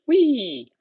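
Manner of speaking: high, loud gleeful squeal; playful whee. Acted. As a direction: squeal it like a kid on a slide. Playful while demoing stretch.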